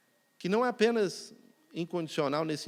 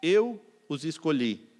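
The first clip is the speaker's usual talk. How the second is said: scripture quote; 4 dB louder, slower than usual. Acted. Quotes John 15:16.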